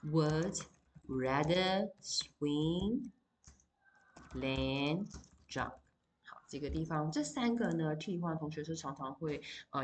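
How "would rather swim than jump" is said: very slow, word-by-word example; slow dictation pace; slow example read. Shown as a demonstration. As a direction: drag it out so students can write along. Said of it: "So for example".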